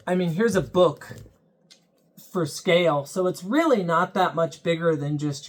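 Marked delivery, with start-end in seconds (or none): none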